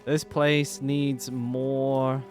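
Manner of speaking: usual speaking voice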